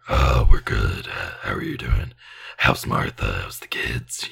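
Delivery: very low, breathy whispering demon. Pretend. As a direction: rasp it out like a polite demon. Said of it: "Your demon voice is too".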